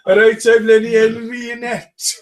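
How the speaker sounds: high, loud mock-Welsh accent; Lenny Henry. Imagining a famous comic as Welsh, loud mock Welsh. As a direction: boom it out in silly Welsh. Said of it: "funny if Lenny Henry was Welsh".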